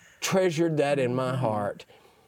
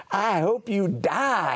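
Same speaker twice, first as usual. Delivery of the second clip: slow, cold, higher curse; imitating mean old lady; 6 semitones higher, slower than usual. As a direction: spit out a slow cruel wish. Old lady curses injured man.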